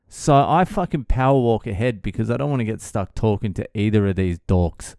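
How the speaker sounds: ordinary delivery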